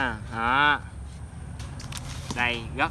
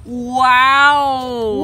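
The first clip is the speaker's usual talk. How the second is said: high, loud gasp of delight; excited wow; 9 semitones higher, 9 dB louder than usual. Real, not acted. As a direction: wow it out, thrilled. Unboxing second fish.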